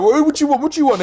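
high, loud rounds-buying call; calling out in the pub. Retold or imitated. Buying a round for the whole pub, loud calling out. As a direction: call over the pub noise offering drinks. Shouting drink orders in pub.

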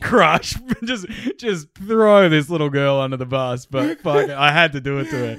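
loud, high, laughing boast. Real, not acted. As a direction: crow it out between laughs. Laughing loud jokey outburst.

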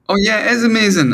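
high, fast, delighted self-agreement; smug excitement. Retold or imitated. Songwriter gloating about his song, high and quick. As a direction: rush it out, thrilled with yourself. Gloating after praise arrives.

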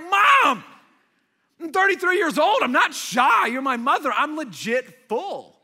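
loud, high protest of a grown son; exasperated yell. Retold or imitated. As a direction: yell at your mom, fed up. Exasperated grown son yelling at mom.

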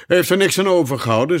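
breezy, unconcerned dismissal; carefree. A narrator's reading. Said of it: "zei de eenhoorn zorgeloos".

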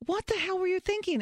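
high-pitched incredulous scolding; mock outrage without added volume; retold exasperation. Wife scolding husband over the kitchen fire, high exasperation.

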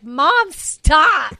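retold plea, both words punched out; embarrassed protest.

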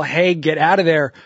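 usual speaking voice

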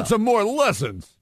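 high, slow Rodney Dangerfield jab; Rodney Dangerfield impression. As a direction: heckle like the Caddyshack comic. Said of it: "the Rodney dance".

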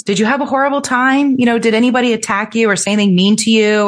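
sugary probing parent voice, rushing; mock-worried ex. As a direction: pile on the worried questions. Ex's questions repeated.

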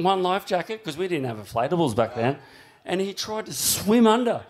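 ordinary talk, nothing marked

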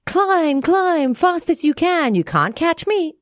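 high singsong taunt. Acted. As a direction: tease them from the treetop. Taunting chant from the treetop.

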